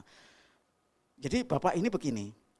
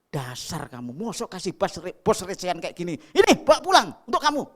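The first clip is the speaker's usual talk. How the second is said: irritated boss; 4 semitones higher, 10 dB louder than usual. Retold or imitated. Boss scolds staff over coins.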